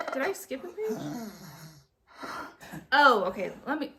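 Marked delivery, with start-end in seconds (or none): none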